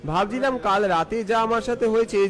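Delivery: ordinary delivery, nothing marked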